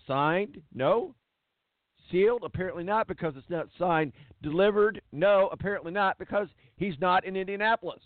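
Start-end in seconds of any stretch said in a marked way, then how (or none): none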